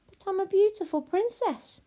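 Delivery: high, indignant princess voice; protesting princess. Acted. Princess objects to witch ignoring her, indignant.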